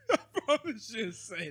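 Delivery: cracking up while talking; laughing. Real, not acted. Laughs at comment.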